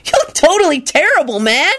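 mild mock holler of a rude kid; rude slacker holler. A narrator's reading. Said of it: "Kenny hollered out rudely".

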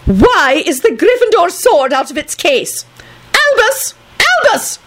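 slow indignant question, then piercing high calls; shrill outrage. A narrator's reading. McGonagall calling Albus about the sword, shrill outrage. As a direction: climb to a shrill summons.

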